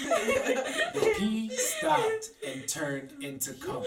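high, slow, laughing hard through it; cracking up. Real, not acted. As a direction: barely get the words out for laughing. Repeats the punchline, cracking up.

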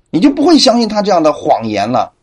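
normal talking voice

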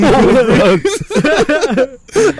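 laughing at his own panic. Retold or imitated. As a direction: laugh out the realization. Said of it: "I'm like".

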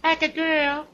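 put-on high falsetto girl voice. Shown as a demonstration. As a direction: switch up into a high girly voice. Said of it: "when I switch my voice".

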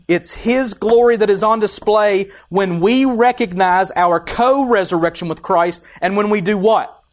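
loud, high preacher's call; preaching at full force. Acted. Pastor urging the church on co-resurrection, loud preaching. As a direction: lift the room with it.

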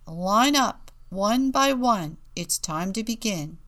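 loud teacher's call, easing at the end. A narrator's reading. Teacher summoning young penguins to line up, calling out. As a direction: call the class to order, loudly. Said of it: "Mister Tux called out".

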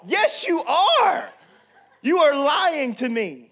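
loud, slow, laughing yell; mock shout. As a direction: shout back at her, half laughing. Father shouts back, laughing.

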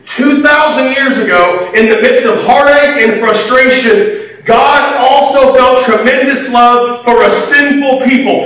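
ordinary delivery

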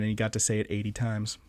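quick snide whisper; real whispered jab under the breath; whispered aside. Real, not acted. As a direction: drop to a fast whisper for the dig. Muttered snarky aside about Tarantino.

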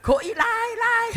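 high-pitched vendor's call; high, sustained beckoning cry; calling someone back. Retold or imitated. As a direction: imitate calling to someone far away. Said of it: "Udah jauh dipanggil".

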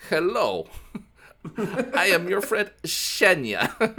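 high, drawn-out mock Russian accent; crazy Russian accent. New Russian exchange student introducing herself, hammed-up Russian accent. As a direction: put on an over-the-top Russian girl's accent. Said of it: "she has the craziest Russian accent".

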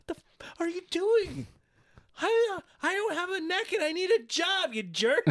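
very high squeaky outraged voice; shrill Paige impression. Paige snapping at CM Punk in a very high squeak. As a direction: squeal it like a cornered wrestler. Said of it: "she says it like this".

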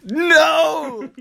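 high, loud startled "No!"; shocked cry. Real, not acted. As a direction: blurt it out in alarmed surprise. Co-host reacts to a slip.

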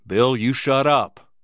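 loud, forceful hushing command; stern order. A narrator's reading. Scully orders cowboy to be quiet.

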